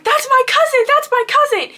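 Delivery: very high, loud frantic cry; imitating a friend's high-pitched scream; retold scream. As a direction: scream out in shock at spotting family. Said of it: "like, screams".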